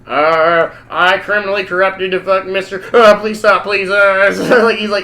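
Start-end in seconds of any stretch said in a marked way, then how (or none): 0.1-3.9 mildly panicked pleading, louder and a bit raised; nervous hostage. Retold or imitated. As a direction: play a flustered hostage stumbling through a forced confession. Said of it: "he's nervous".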